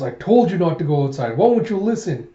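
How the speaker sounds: acted scolding. Guardian scolding a child who went outside, exasperated. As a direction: tell a child off, pitch climbing on the question.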